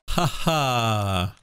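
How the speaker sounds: ordinary talk, nothing marked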